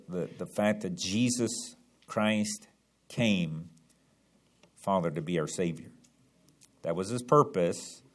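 soft, low, hushed prayer. Acted. Pastor leads the congregation in prayer, soft hushed prayer. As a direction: pray quietly and humbly to the Father.

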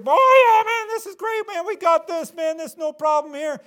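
very high, loud hammed-up cheering; cocky excited Israelites. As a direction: play a crowd certain of victory. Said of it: "Boy, they're".

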